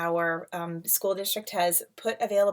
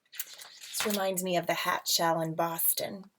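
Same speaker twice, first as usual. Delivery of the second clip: plain reading voice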